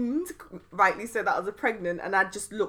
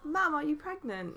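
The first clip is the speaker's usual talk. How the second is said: soft, higher half-whisper; retold hushed question; 6 semitones higher, 9 dB quieter than usual. Student asks teacher an awkward question, hushed imitation. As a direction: mimic a pupil daring to ask quietly.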